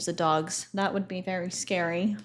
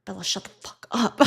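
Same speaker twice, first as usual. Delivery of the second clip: hissed under the breath at the dog; sharp whisper scolding the dog; whispered curse; 4 dB quieter than usual. Retold or imitated. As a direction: whisper it fiercely so the neighbors miss it. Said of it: "and I was like".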